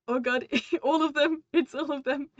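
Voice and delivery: real laugh breaking into a loud groan of disbelief; laughing dismay. Real, not acted. Reacting in dismay while laughing.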